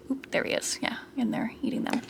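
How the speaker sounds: soft, fast whisper. Real, not acted. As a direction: hush so the pet stays calm. Whispering not to disturb hamster.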